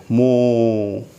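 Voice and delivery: long, low मो with silent R; British pronunciation. Shown as a demonstration. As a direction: hold the vowel in मो, no final R. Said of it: "मो बोलते हैं".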